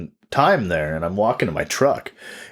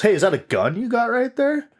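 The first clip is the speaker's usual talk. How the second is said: much higher, suspicious; high mock homeless guy; 14 semitones higher than usual. Homeless guy eyeing the tripod, high and suspicious. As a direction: ask it high and wary. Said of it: "he goes".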